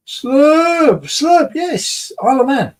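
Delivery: squeaky falsetto reading; high squeaky voice. Pretend. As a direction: pitch way up, squeak it out.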